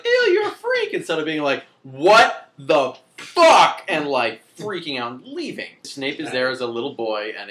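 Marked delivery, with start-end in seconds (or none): 0.0-0.9 put-on much higher, louder bratty little girl; mock snotty girl. Girl mocks friend doing magic. 1.8-3.8 much louder and very slow shocked cry; mock shocked outburst. How girl should have reacted.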